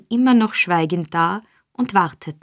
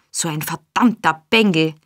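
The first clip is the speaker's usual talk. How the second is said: ordinary delivery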